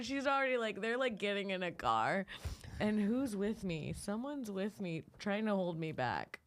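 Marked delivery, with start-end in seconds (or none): none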